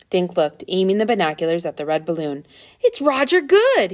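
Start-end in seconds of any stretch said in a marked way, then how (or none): 2.8-3.9 high, slow, loud cry of recognition; excited cry. A narrator's reading. Boy recognizing a TV star through binoculars, excited cry. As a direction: call it out amazed. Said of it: "he cried".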